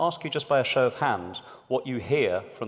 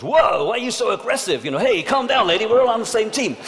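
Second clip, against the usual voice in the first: high, louder mock "whoa, calm down"; mocking colleagues; 9 semitones higher, 8 dB louder than usual. Speaker mocking colleagues scolding a woman, high and loud. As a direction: lampoon condescending men in a meeting. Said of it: "everyone else in the meeting goes".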